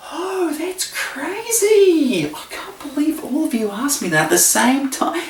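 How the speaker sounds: high, hammed-up astonishment; mock surprise. Host pretending the cued question is unexpected, mock amazement. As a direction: feign utter disbelief, drawing it out.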